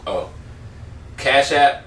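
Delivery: ordinary talk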